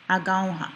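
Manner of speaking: "agaoha" as a-GAU-ha; second-last syllable stress. Shown as a demonstration. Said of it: "stress usually the second last syllable".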